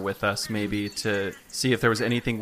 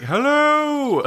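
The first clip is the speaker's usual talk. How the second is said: booming Monty Python hello; big boomed greeting, high and loud; magically amplified voice; 13 semitones higher, 5 dB louder than usual. Shown as a demonstration. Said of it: "make my voice really big".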